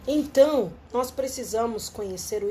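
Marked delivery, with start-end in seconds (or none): none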